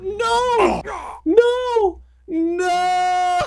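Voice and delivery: very high, hammy squeaky no; squeaky wail. Pretend. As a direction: squeal it like a cartoon. Wails at the failure, mock despair.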